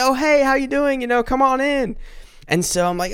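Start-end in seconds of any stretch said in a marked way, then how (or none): 0.0-2.0 cheerful welcome. Retold or imitated. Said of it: "And they're like".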